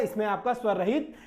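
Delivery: ordinary delivery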